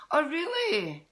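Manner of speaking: high, delighted oh really; light up at the news; pleasantly surprised pitch leap. Real, not acted. Reacting to viewer comment.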